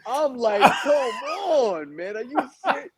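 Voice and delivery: a pained, incredulous complaint; disbelief. Retold or imitated. Retold disbelief at the label.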